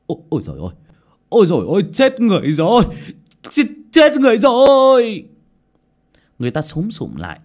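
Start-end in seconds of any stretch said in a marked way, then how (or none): 1.3-5.3 much higher, louder scream building up; panicked shout. A narrator's reading. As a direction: cry out in horror at the body. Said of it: "hét toáng lên". (hét toáng lên hoảng loạn, càng lúc càng cao và to)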